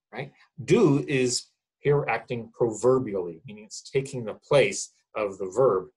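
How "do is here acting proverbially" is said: usual speaking voice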